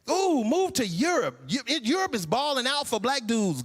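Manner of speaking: usual speaking voice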